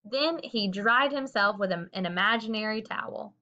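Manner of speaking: plain reading voice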